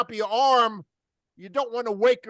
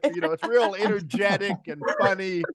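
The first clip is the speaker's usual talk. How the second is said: usual speaking voice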